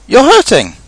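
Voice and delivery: high, loud protest; pained cry. A narrator's reading. Pooh protesting as Rabbit pulls, pained cry. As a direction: complain loudly that it hurts. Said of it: "cried Pooh".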